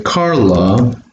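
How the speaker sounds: very slow, word by word with pauses; teacher dictating as he writes; dictating slowly. Shown as a demonstration. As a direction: pace it out word by word. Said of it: "write those examples".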